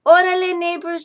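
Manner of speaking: high, loud Órale, friendly cat; purring cat. Acted. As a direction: greet the neighbors bright and high. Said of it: "Chato purred".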